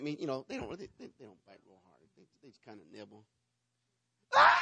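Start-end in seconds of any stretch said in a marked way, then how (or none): 4.3-4.6 high, sharp mock cry of pain; overdone shriek of pain; dramatic yelp. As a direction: yelp like you've been bitten. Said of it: "I was so dramatic".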